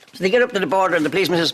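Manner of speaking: ordinary talk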